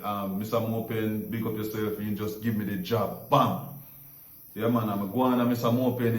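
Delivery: ordinary delivery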